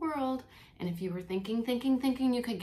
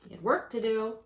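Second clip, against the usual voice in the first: put-on lively read-aloud for children; reading the poem to the class, with expression; storybook reading; 5 dB louder than usual. Teacher reading Christmas poem to kids, animated storytelling.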